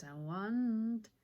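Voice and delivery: swelling, forceful declaration of desire; insistent demand. Acted. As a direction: push the wanting out loud. Rehearsing the character's objective.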